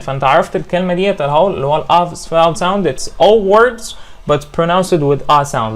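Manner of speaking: normal talking voice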